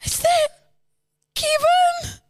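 very high, slow, tearful screaming; full-on acted hysterical crying; anguished wail. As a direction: wail in horror at a terrible loss. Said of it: "I felt the emotion".

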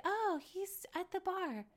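put-on quoting Raquel defending her cheating boyfriend, high and soft; imitating Raquel. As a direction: play a naive girlfriend making excuses. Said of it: "also just like".

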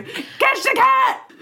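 high, slow mock yell of catch the cat; mock shout. Balloonist yelling down to the crowd, high slow mock shout. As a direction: fling the words down like a frantic balloonist. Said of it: "That's what I would do".

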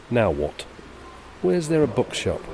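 light lad's voice, rising on the question; lightly voiced Billy asking; voicing Billy. Reading Billy's dialogue aloud.

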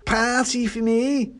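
high, slow tipsy wonder; drunken pudding, thrilled at the party. A narrator's reading. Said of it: "has definitely been drinking".

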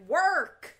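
loud high barked order; mock-bark it like a fed-up mom; stern mom. Mom ordering her daughter to work, loud high mock bark. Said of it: "her mom is like".

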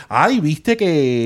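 drawn-out gossip opener, clearly put-on voice; gossipy Cuban lady on speaker. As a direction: stretch it like juicy chisme. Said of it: "it's like full".

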